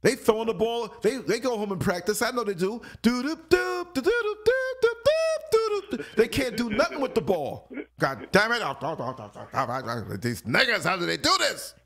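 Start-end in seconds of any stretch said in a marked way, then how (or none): 3.0-6.0 high loud doo-doop dribbling sounds; high, loud vocal dribble rhythm; dribbling noise. Retold or imitated. Imitating ball dribbling sound. 8.0-11.7 frustrated player. Retold or imitated.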